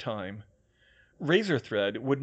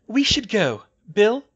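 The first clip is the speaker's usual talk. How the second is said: high, loud urging; high-pitched prompt ending in a question; Hermione's voice; 10 semitones higher, 9 dB louder than usual. A narrator's reading. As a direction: call to a companion in a raised character voice. Hermione urges Bill, voiced by narrator.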